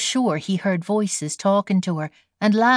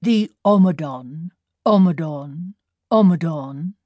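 deep cracked bullfrog; 3 semitones lower than usual. A narrator's reading. Bullfrog calling across the dark meadow, deep cracked voice. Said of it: "the deep cracked voice of a nearby bullfrog".

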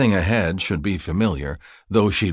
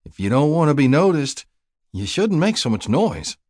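higher-pitched, pointed reproof; mild scolding; 8 semitones higher than usual. A narrator's reading. Wanda answers the shrieking woman on the road, mildly scolding. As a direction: lightly tell her off.

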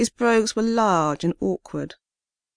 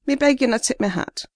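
put-on older Caribbean man, pleading, higher; gentle Caribbean lilt, higher; Caribbean accent; 6 semitones higher, faster than usual. Rufus pleads with police officer.

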